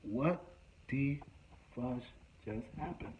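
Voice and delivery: soft, hushed disbelief; stunned hush. Retold or imitated. Teller stunned by the chaos, hushed. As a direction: murmur it in shock. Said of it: "I'm just like".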